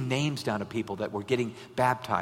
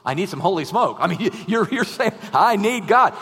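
loud, high desperate cry for help; much louder, higher mock desperation; 6 semitones higher, 9 dB louder than usual. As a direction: call out at the end of your rope. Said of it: "It's like".

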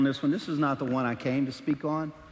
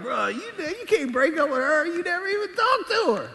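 incredulous scolding; 11 semitones higher than usual. Retold or imitated. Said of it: "It's like".

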